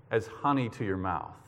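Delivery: normal talking voice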